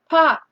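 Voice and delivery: a high, loud popping pop; bright mock pop; pop sound. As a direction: pop it like a cork.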